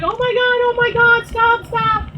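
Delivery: high, loud panicked pleading, mimicked; imitated panic. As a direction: mimic frantic friends begging for a stop. Mimicking the girlfriends begging her to stop.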